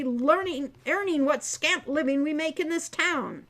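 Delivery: loud, outraged father ranting; furious. A narrator's reading. Angry centaur father forbidding his daughter's courtship, loud and outraged. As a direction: boom out the father's indignation.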